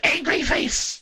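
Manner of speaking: high chirpy mock text-to-speech; imitating the TTS voice. Streamer copying the chat TTS voice, high and mock-robotic. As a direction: parrot the robot voice back, delighted. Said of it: "did you hear the T T S".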